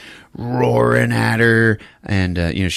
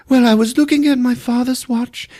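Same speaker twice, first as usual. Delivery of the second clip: prim English lady. Retold or imitated. Eve telling Hugh what she saw, hammed-up high prim lady. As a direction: play a flustered posh lady. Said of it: "Eve's voice again".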